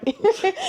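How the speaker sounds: short high burst of laughter; laughs. Real, not acted. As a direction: laugh it out, amused. Laughs at dog on wheels.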